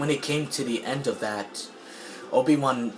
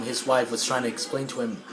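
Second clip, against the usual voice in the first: ordinary talk, nothing marked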